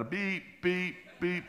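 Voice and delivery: high, even car-alarm beeps; shrill-pitched repeated alarm beep. Retold or imitated. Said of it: "this car alarm goes off".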